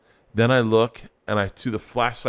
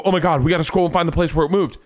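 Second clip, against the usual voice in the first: excited rush; 6 semitones higher, 3 dB louder, faster than usual. Retold or imitated. Retelling urgent search.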